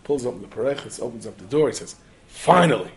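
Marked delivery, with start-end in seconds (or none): 2.3-2.9 relieved exclamation. Acted. Shamus finds challahs, acted relief.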